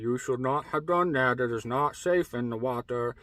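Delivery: a hint of a low giant's voice; giant man. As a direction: drop the pitch slightly for the big man. Giant man's line in retold story.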